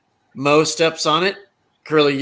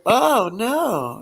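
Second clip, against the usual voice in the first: put-on denial; 7 semitones higher than usual. Stooges in disguise answer the guy.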